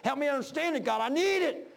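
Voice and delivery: a man crying out to God for help; pleading prayer. Retold or imitated. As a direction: beg God for understanding, voice rising. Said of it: "I was crying out to God".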